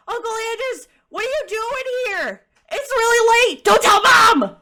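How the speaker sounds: put-on high-pitched excited kid voice; little boy calling. Little boy to his uncle from the window, high and excited. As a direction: pipe up high like a child at a window.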